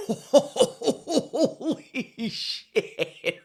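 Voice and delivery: high, laughing Holy shit; laughing in disbelief. Real, not acted. Vlogger laughs out loud at the Irish accent headline.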